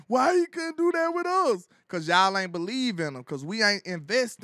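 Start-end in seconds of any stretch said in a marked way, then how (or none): 0.1-1.7 mock whiny fan, pitched up; hammed-up crybaby fan voice; whining fan. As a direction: whine it like a sulking fan. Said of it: "a bunch of whine".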